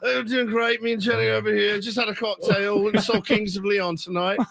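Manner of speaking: high, rambling mock British accent; hammed-up British rocker chat. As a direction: play a merry tipsy Englishman. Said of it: "like, [name] on me".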